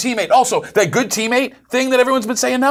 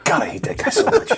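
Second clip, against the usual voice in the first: breathy exasperated whisper; 11 semitones higher than usual. Real, not acted. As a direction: hiss it under your breath. Exasperated aside.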